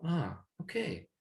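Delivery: high, hushed sigh of realization; soft realization. Retold or imitated. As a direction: let it dawn on you, softly. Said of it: "you're like".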